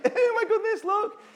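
mock giddy gush. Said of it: "It's not like".

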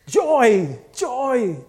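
loud, high cry of joy; exuberant shout. Retold or imitated. Worshipper who wants what she has, exuberant shouting.

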